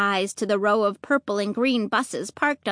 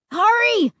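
high, urgent cry; sudden shout; 10 semitones higher, 4 dB louder than usual. A narrator's reading. Luke shouts a sudden warning to the family. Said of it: "Luke shouted suddenly".

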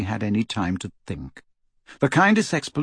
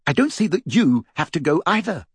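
sharp, irritated retort; snapped; 6 semitones higher than usual. Acted. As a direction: snap back at him. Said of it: "she snapped".

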